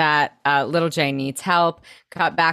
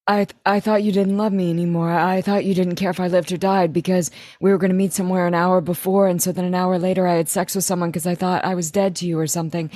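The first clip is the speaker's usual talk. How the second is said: fast, run-on acted confession; rushed excuse; 2 semitones higher, faster than usual. Chuck explaining himself to Blair, rapid rambling confession. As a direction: gabble the whole explanation without a break.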